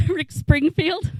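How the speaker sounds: normal talking voice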